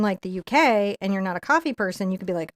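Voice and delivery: usual speaking voice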